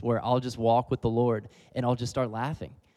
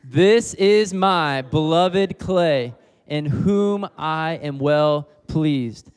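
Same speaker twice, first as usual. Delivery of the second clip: loud, slow and high, each word stretched out; loud slow declaration; 4 semitones higher, 6 dB louder, much slower than usual. Shown as a demonstration. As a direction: proclaim it aloud to the whole room. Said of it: "Okay, now for real this time".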